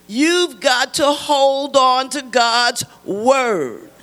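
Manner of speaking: loud, emphatic church preaching. Real, not acted. Preacher exhorting listeners to obey God, loud preaching. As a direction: drive it home to the congregation at full voice.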